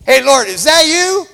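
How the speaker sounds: high, loud calling across the water; excited shout toward a distant figure; Peter calling out. Retold or imitated. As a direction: call out to Jesus over the waves. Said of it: "Peter goes".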